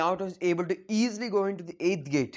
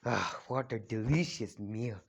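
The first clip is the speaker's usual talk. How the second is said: creepy villain; 5 semitones lower, 5 dB quieter than usual. Retold or imitated. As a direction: savor it like a predator eyeing a meal. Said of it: "he's like".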